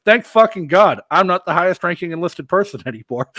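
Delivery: relieved soldier handing off command. Retold or imitated. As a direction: cheer that someone else is in charge. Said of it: "I'm like".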